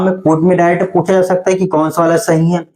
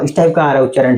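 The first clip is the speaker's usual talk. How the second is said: usual speaking voice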